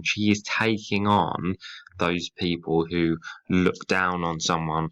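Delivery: ordinary delivery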